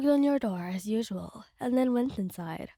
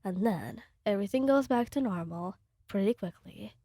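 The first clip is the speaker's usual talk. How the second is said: ordinary delivery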